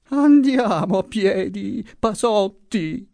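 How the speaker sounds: put-on high, slow, thin old voice pleading; feeble moan again. A narrator's reading. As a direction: drag out the plea. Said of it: "gemette ancora la voce".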